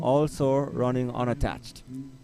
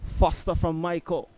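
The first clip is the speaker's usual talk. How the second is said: race caller; 3 semitones higher than usual. Acted. Live call of men's race.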